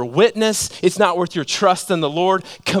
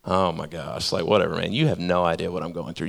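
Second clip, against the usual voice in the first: much lower, softer sulky mumble; mopey whine; 10 semitones lower, 6 dB quieter, faster than usual. Retold or imitated. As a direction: sigh it out, fed up and sorry for yourself. Sulky reply back in conflict.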